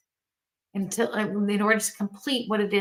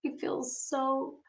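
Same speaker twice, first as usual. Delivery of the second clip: put-on discouraged child; 4 semitones higher, 3 dB quieter than usual. Daughter clinging to her mother after practice, softly discouraged. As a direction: imitate a let-down child, softly and haltingly. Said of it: "runs into my arms and she holds me tight".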